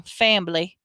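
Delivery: "fambly" with a b slipped in after the m; Southern "fambly". A narrator's reading. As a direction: say "fambly", two syllables, Southern style. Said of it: "sounded like she was saying".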